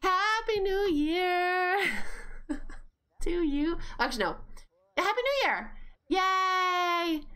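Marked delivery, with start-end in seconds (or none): 0.0-3.8 high, long drawn-out cheer; excited greeting. Real, not acted. Streamer greets a viewer for New Year, excited. 4.9-7.2 high celebratory cheer; excited cheer. Real, not acted. Streamer celebrates New Year with chat, excited.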